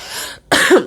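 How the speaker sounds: short unvoiced cough; real dry cough. Real, not acted. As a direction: clear the throat with a cough. Cough before apologizing for wheeze.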